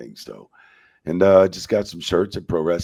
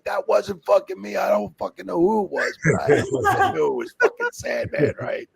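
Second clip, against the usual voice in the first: frantic denial; 13 semitones higher than usual. Retold or imitated. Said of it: "I'm like".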